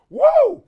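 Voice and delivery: loud, high "woo"; whooping. Real, not acted. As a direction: burst out with a sharp whoop. Exclaims over son's fall.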